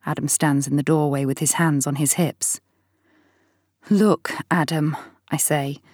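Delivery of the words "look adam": slow, whispered sigh of a word. Acted. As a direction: breathe it out tiredly. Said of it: "sighing as I switch off".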